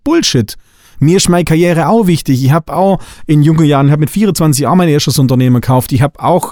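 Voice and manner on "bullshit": scornful dismissal. Real, not acted. Dismissing a friend's excuse.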